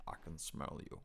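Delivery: low, soft Morgan Freeman rumble; Morgan Freeman impression. Retrying the Morgan Freeman line for his friend, low. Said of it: "Morgan Freeman's voice".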